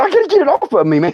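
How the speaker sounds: high-pitched comic pleading; mock urgent plea. Man with snake on him to his buddy, mock urgent plea.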